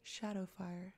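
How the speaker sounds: soft, silken breath of a word; a strange lady answering, barely voiced. A narrator's reading. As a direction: breathe it out softly. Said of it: "a silken female voice breathed".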